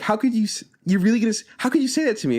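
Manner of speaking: high, hammed-up wounded girl voice; mock hurt girl. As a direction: squeak it like a betrayed schoolgirl. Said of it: "She's like".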